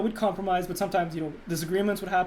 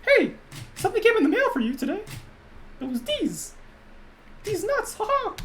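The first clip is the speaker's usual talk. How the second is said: very high cartoon mouse falsetto; high mouse voice, chuckling at the end; Mickey Mouse; 12 semitones higher than usual. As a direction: tell a joke as a squeaky cartoon mouse. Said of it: "D's nuts in Mickey Mouse voice".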